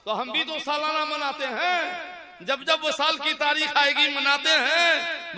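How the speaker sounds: loud, high preaching voice; fiery preaching. Acted. Preacher rousing the crowd on the yearly urs, loud and high. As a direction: rouse the gathering.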